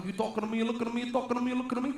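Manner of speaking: squaring up like a movie gangster; a hint of Italian-American mobster; gangster Sopranos. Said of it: "kind of gangster Sopranos".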